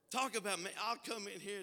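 usual speaking voice

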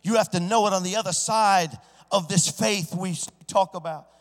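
usual speaking voice

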